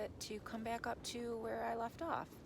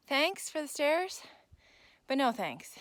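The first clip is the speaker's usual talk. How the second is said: sarcastic; 6 semitones higher, 10 dB louder than usual. Real, not acted. Sarcastic thanks for steep stairs.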